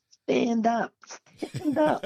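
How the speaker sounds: high, small voice from far below; lightly voiced little ant; tiny far-off voice. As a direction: call up like a tiny figure on the ground. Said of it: "this little ant down the bottom say".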